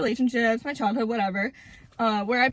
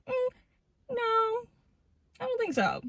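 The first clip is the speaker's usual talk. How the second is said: high, soft little refusal; mock dismissive reply, pitched way up; dismissive brush-off; 11 semitones higher, 3 dB quieter than usual. Acted avoidant brushing off partner.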